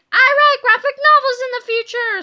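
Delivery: high, loud shout of joy; overjoyed scream. A narrator's reading. Boy learns his future career, screaming with joy. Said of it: "screamed George".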